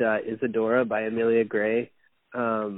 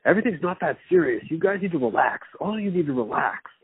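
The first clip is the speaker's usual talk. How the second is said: mock delivery guy telling rich residents to relax; imitating the delivery guy; 7 semitones higher than usual. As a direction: parrot the guy lecturing everyone to relax. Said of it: "He's like".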